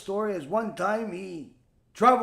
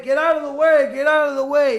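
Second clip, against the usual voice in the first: loud, higher-pitched shout through a crowd; calling out urgently; 5 semitones higher, 9 dB louder than usual. Acted. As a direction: yell to clear a path. Man pushes through crowd in story.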